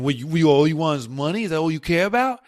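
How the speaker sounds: put-on loud, brash New York accent. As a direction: bark it like a suspicious New Yorker. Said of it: "that's my New York accent".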